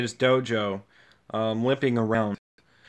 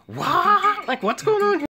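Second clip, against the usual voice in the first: much higher, confused little fighter; high Krillin voice. Retold or imitated. Krillin confused by the fight, squeaky and high. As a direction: squeak it out, baffled.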